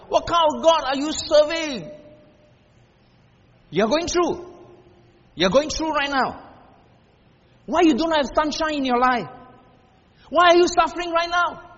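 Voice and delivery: high, loud, ringing sermon cry; full-force preaching. Real, not acted. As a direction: thunder the doubters' questions back at the crowd.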